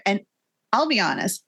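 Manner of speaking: normal talking voice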